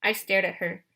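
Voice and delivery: plain reading voice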